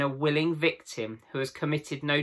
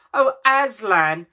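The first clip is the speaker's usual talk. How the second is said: high, louder, slow cry of wonder; children seeing Aslan alive, crying out; amazed cry; 9 semitones higher, 9 dB louder, slower than usual. A narrator's reading. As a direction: cry out half glad, half frightened. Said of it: "cried both the children".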